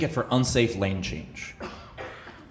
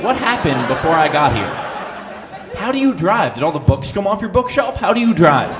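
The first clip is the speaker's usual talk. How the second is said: louder and higher, mock outrage; exasperated rant; 6 semitones higher, 7 dB louder than usual. Real, not acted. Comedian ranting about the officer's driving, louder and higher. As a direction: rant it up, disbelieving. Ranting at the cop's messy car.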